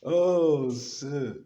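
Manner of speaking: breaking up into breathy laughter; laughing. Real, not acted. Laughing helplessly after telling the story. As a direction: let the laugh take over the word.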